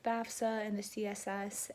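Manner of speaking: normal talking voice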